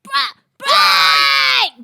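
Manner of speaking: very loud high scream. Shown as a demonstration. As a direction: belt it out shrieking. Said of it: "I still sound like a little kid screaming".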